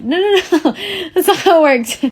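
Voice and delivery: high, laughing mock reply; laughing denial. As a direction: laugh through the no no no. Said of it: "And I was like".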